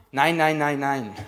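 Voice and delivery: put-on laughing little kid saying no; a toddler echoing his mother's no, fading; child imitating mom.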